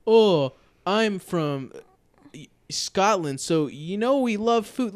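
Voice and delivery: mocking cooking-show contestants. Host imitating cooking-show contestants, loud and mocking. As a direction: stretch it out in exaggerated pride. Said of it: "people on cooking shows are like".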